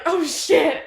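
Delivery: loud, high exclamation; acted alarm. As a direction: blurt it in mock panic. Richie's alarm in the retelling.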